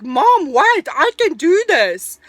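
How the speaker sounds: loud, high, slow insistence; insistent plea. Retold or imitated. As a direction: imitate a son pleading to be let try. Son voiced insisting to mother.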